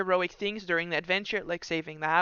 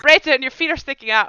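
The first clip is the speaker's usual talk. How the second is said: high, loud in-character yell; called-out warning; 7 semitones higher, 13 dB louder than usual. Acted. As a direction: call to a friend while running away. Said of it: "You just hear her go".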